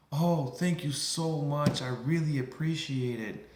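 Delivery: grateful relief. Acted. Customer thanks the clerk who offered help, effusively grateful. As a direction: play up a relieved customer's gratitude.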